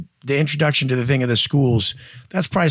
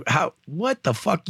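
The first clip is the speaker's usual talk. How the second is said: slow, hushed, awed swearing; quietly floored, dragging the words; stunned disbelief; 4 semitones higher, 5 dB quieter, slower than usual. Retold or imitated. Guy stunned by his sales.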